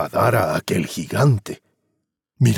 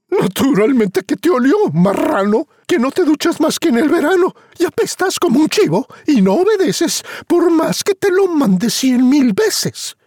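high, loud nagging rant; scolding mother; 3 dB louder than usual. Acted. Mother scolds Juan for never bathing. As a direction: tear into him for being smelly.

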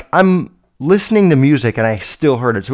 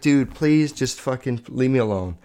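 quieter, understated pleading; retold plea; 2 semitones lower, 7 dB quieter than usual. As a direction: ask softly to be left in peace. Retells pleading with hostile kid.

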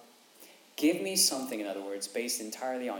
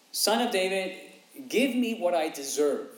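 higher, louder entitled demand; demanding beggar; 6 semitones higher, 6 dB louder than usual. Retold or imitated. Beggar demanding his due from Jesus, higher and louder. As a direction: claim it as owed, pushing hard. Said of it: "Bartimaeus isn't here saying".

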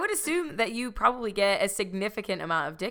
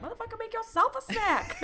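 high, put-on fry voice of a fed-up mom; vocal fry mom. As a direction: snap at the kid in a mock fry voice. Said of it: "With that voice".

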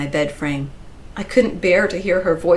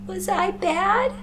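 put-on slow, very high kid voice; small, high, tearful question; pleading child; slower than usual. A narrator's reading. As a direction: ask like a hurt little girl. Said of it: "the sound of a child pleading".

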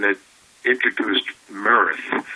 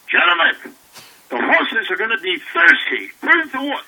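much higher, pompous cavalry officer voice; Jonathan Winters as old colonel; 4 dB louder than usual. Retold or imitated. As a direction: play a grand old colonel barking orders. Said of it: "he goes".

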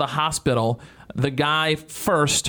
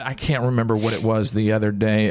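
usual speaking voice

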